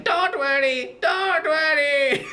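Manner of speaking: high, slow creepy crone; creepy old lady. Retold or imitated. Old lady to the kid trying to escape, creepy croon. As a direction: put on an eerie old-woman voice, drawn out. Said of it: "the creepy old lady's like".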